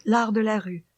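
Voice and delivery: plain reading voice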